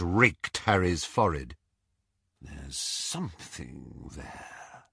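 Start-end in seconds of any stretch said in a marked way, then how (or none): 2.4-4.8 slow hushed whisper. A narrator's reading. Lucius studying Harry's forehead, whispering. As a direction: whisper it slowly, very low. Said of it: "he whispered".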